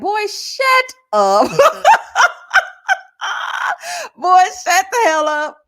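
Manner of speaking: loud, high and laughing, telling him to shut it. Real, not acted. Scolding the man on screen, laughing and loud. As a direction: cackle and yell at him to shut up.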